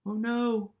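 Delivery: ordinary delivery, nothing marked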